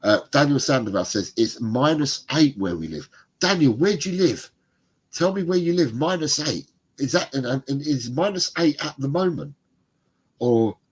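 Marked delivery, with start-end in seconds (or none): none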